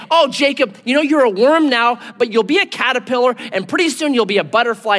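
high, gushing pep talk; singsong mock encouragement; mock consoling. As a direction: reassure him with overdone sweetness, quickening. Mock consoling God never gives.

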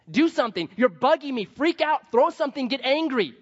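loud, high, agitated urging; exasperated relatives. Acted. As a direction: act out fed-up relatives pushing for a reaction. Voicing exasperated unbelieving relatives.

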